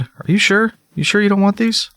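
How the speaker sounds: high, wheedling pet-talk voice; coaxing an animal. Real, not acted. Player offering pumpkin seeds to a raven, coaxing.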